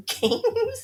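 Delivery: high laughing question; giggling, can't believe it; laughing disbelief. Real, not acted. Incredulous at funeral games.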